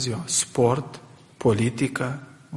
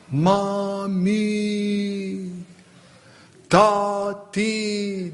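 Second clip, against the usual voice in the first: imitated child calling; 4 semitones higher, much slower than usual. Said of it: "Strigă cât îl țin puterile".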